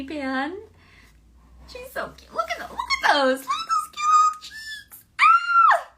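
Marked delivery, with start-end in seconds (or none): 1.7-5.9 very high, squealing baby cartoon voice; cute baby voice squealing with delight; baby Pan voice. Shown as a demonstration. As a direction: gush like a tiny cartoon baby over her cheeks. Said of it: "so here you go, Susan".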